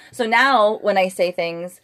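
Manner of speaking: ordinary delivery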